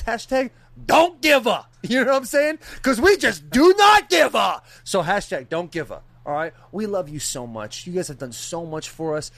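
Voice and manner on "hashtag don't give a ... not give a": loud, high, drawn-out hype shout; shouted catchphrase. Real, not acted. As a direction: belt out the slogan, stretching the words. Hyping the show's catchphrase.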